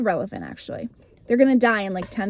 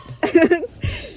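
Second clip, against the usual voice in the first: higher embarrassed giggle; 7 semitones higher, 3 dB louder than usual. Real, not acted. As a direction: giggle sheepishly.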